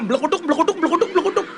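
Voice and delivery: high bubbling Ngapak gibberish; mock Ngapak dialect. Comedian mimics how Banyumasan people talk, mock Ngapak. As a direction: parody Ngapak speech as bouncy plosive babble. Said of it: "Ngomongnya gimana?".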